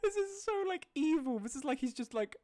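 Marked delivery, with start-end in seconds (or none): 0.0-1.4 squeaky high laugh breaking through the words; saying it while still cracking up. Real, not acted. Laughing at a creepy image.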